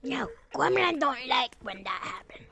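whispered gremlin voice; creepy whispering gremlin. Acted. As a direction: hiss it secretly like a sneaky gremlin. Gremlin whispering in game chat.

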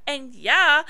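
normal talking voice

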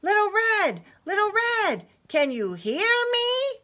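high, loud storybook call; yelling. Acted. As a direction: call out to a lost friend far below. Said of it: "sounded the alarm on his trumpet and yelled".

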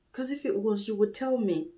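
ordinary talk, nothing marked

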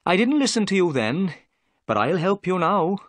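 a proud officer owning up to a mistake; humbled inspector. A narrator's reading.

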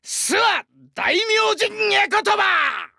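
high, drawn-out warrior's shout; battle invocation. Acted. Warrior going into battle for the homeland, shouted invocation. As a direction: proclaim it slowly at full cry.

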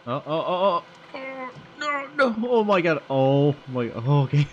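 loud frightened cries, pitch jumping up; panicked. Real, not acted. Gamer panicking while the ghost chases them, loud frightened cries.

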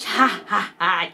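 slow, deliberate "ha, ha, ha"; put-on stately chuckle; imitated fairy laugh. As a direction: laugh grandly like an old fairy. Fairy laughs before granting wish.

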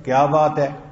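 loud, short challenge; slightly angry. Shown as a demonstration. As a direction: demand it with mild irritation. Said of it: "अगर जरा गुस्से में".

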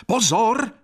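high, sudden call of warning; big, ringing shout; resonant shout. A narrator's reading. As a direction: cry out to halt everyone. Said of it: "vzkřikl malý Heribert hlasem k neuvěření širokým a zvučným".

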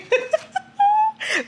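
high squealing laugh that cracks. Real, not acted. After reading the couple's banter aloud, high squealing laugh.

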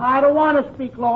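ordinary talk, nothing marked

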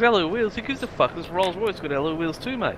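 high sneering Rolls Royce owner; scoffing rich bloke. Retold or imitated. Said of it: "He goes".

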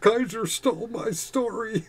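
high, whiny crybaby imitation; mock crying. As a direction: whine it like a mock sob, mocking his complaint. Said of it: "back when he was crying".

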